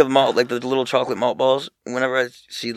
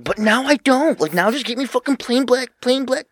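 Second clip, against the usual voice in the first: high, loud, dragged-out whine; whiny mock yell; 5 dB louder than usual. Son whining loudly at mom for plain clothes. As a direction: whine it up high like a bratty kid.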